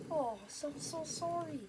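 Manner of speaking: ordinary talk, nothing marked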